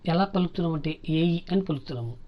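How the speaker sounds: usual speaking voice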